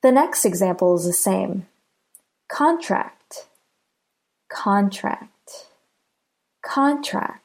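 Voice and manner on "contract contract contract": "Contract" with CON longer and stronger; "Contract" as a noun, CON-tract; stress on first syllable. Shown as a demonstration. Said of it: "Do you hear how con is stressed?".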